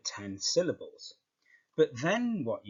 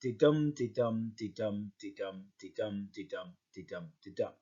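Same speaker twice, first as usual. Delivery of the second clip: steady "de-dum" beats, weight on each "dum", fading away; heartbeat rhythm; 4 semitones lower than usual. Shown as a demonstration. Teacher showing how people think of iambic pentameter, heartbeat rhythm. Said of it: "think of it in terms of a heartbeat".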